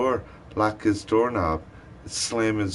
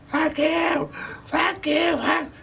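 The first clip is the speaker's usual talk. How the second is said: very high, shrill mocking voice; mock cussing; slower than usual. Mimicking the neighbour cussing out her kid in a very high voice. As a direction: screech the curses in a mocking high voice. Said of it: "cussing him out".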